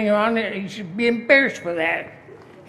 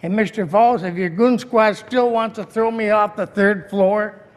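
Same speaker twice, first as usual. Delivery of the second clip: ordinary delivery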